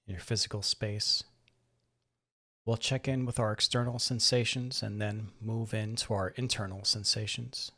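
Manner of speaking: calm, measured voice with long pauses; guided meditation. Acted. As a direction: gently guide listeners through breath and body, softening as they settle. Leads a guided breathing meditation.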